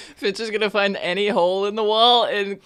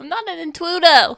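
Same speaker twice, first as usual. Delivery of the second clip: higher, louder little rat; 6 semitones higher, 4 dB louder than usual. Acted. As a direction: squeak it like a rat. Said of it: "Is that your rat voice?".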